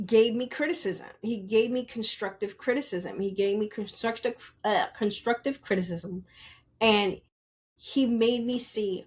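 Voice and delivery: usual speaking voice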